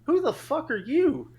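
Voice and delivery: put-on high, slow, indignant kid challenge; imitated little boy. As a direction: mimic a small boy demanding an answer. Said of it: "he looks at me and goes".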